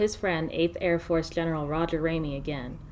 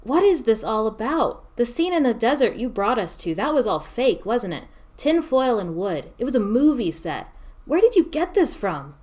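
higher, louder accusing questions; agitated protest; 5 semitones higher, 4 dB louder than usual. Acted. Scientist confronting officers about the fake set, loud. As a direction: press them, rising to a peak.

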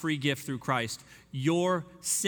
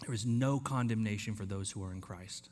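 There is no condemnation, softer and lower; soft, settling repeat; 5 semitones lower, 5 dB quieter than usual. Shown as a demonstration. Preacher letting it settle in, soft and low. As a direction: let it settle in gently. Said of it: "Let that fall over you".

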